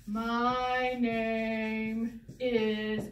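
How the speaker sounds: drawn out extremely slowly, word by word; kindergarten formal. Shown as a demonstration. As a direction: stretch every word as if teaching kindergartners. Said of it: "how we teach kids in kindergarten".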